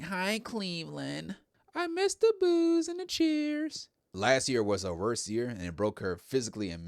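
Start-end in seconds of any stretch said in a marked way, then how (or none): 0.0-3.9 mock bored greeting, much higher and slow; unenthusiastic Charlotte Flair. Recapping Charlotte's greeting to the Cleveland crowd, mock unenthusiastic, high and slow. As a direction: sneer the hello out, dragging every word. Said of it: "in a unenthusiastic way".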